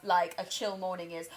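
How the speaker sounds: ordinary talk, nothing marked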